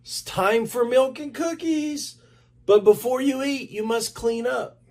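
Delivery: high, bright teacher voice; teacher Mister Lester. A narrator's reading. Mister Lester to the children, high and cheery. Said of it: "Mister Lester said".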